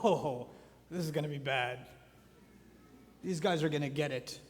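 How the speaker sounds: low-pitched knowing dread; a hushed "uh-oh" reaction; ominous anticipation. Acted. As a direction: mutter it low, like trouble is coming. Imagined listener dreading what follows.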